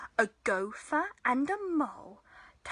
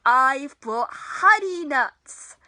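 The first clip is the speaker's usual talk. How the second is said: grinning badger; 12 dB louder than usual. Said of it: "Badger says with a grin".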